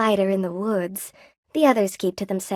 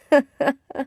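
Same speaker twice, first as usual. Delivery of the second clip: high chuckle; 5 semitones higher than usual. Acted. Character laughs at own joke.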